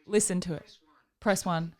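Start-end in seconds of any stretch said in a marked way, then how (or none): none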